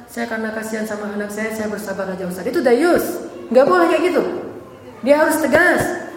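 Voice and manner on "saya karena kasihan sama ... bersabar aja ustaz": soft, low, resigned reply; resigned husband. Acted. Husband explaining he stays for his child, resigned. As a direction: admit it quietly, voice sinking.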